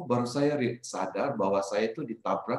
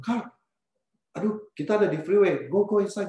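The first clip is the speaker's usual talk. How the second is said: higher, urgent shout to get inside; urgent call; 8 semitones higher than usual. Retold or imitated. Driver calling to the bleeding friend on the freeway, urgent higher call. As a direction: hurry him back into the cab. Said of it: "saya bilang".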